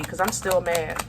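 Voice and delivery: ordinary talk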